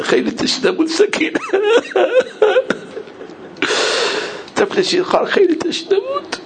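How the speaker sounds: very high, wailing cry; sobbing lament. Retold or imitated. Voicing Abbas grieving for thirsty Sakineh, sobbing lament. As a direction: break into sobs on a soaring pitch.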